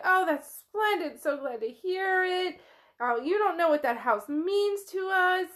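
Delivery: gushing delight, pitched high; light up and gush, voice lifted; suddenly delighted. Acted. Relatives thrilled to hear she'll keep the house, gushing delight. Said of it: "like a bright light snaps on".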